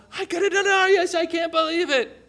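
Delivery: high, giddy gloating; elated disbelief. Acted. Men paid a denarius going by in the dark, elated. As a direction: crow it high, thrilled at your luck. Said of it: "These men going".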